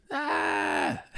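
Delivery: soft high-pitched mock scream; small, very high comic shriek. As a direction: let out a tiny falsetto cry. Jokey cry after fight story.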